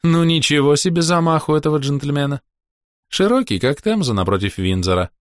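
high purring lady's voice; purring woman. Acted. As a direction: purr it out, amused. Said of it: "промурлыкал женский голос". (мурлыкающий женский голос)

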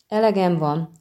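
ordinary delivery

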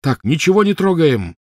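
loud, high desperate cry; desperate shout. A narrator's reading. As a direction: shout in panic. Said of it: "отчаянно крикнул Гарри".

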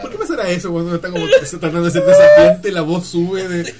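habla entre carcajadas, más fuerte; riendo. Real, not acted. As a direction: se ríe mientras lo cuenta. Laughing while describing rising voice. (riendo a carcajadas)

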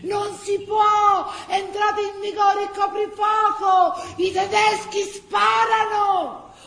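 high, loud, slow warning; urgent shout. Acted. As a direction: shout them back from danger. Men warning of curfew.

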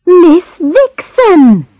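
put-on high, drawn-out exclamation from a girl; exasperated cry. A narrator's reading. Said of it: "she cried".

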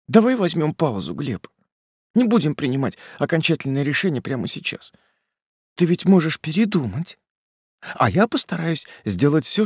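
put-on high, pleading young woman; tearful bargaining. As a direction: bargain gently, holding back tears. Polina bargains for time.

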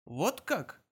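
bewildered. A narrator's reading. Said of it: "с долей растерянности проговорил".

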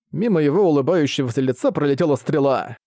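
ordinary delivery, nothing marked

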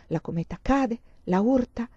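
usual speaking voice

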